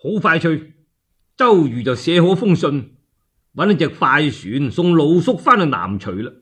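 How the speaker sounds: usual speaking voice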